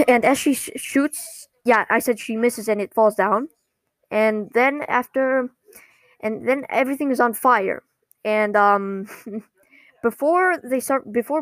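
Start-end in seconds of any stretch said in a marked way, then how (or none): none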